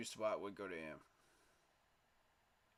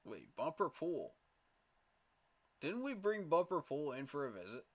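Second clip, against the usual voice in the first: sudden excitement; 5 semitones higher, 5 dB louder than usual. Real, not acted. Sudden idea during draft.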